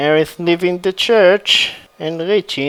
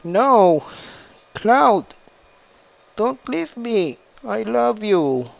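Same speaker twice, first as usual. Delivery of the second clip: crying; 5 semitones higher than usual. A narrator's reading. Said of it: "starting to cry and saying".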